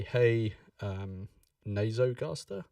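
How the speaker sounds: ordinary talk, nothing marked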